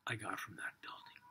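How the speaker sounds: soft whispered confession; reverent whisper. Acted. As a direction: hush it like a sacred truth. Prophet's hushed reverent answer.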